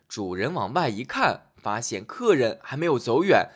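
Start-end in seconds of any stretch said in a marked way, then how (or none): none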